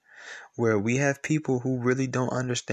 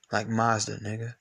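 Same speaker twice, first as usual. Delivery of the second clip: usual speaking voice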